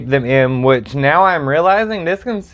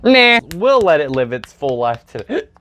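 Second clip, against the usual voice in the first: normal talking voice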